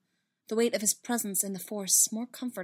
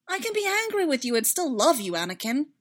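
high, loud, heartfelt retort; heated declaration, easing on the name; exasperated insistence; 7 semitones higher, 6 dB louder than usual. Acted. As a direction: insist on love despite anger. Padme insisting during the quarrel.

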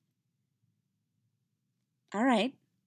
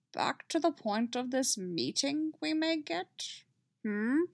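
slow, higher Yoda voice; lightly voiced Yoda; 5 semitones higher, 4 dB quieter than usual. Yoda urging the council back on point, slow. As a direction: Yoda nudging the meeting along.

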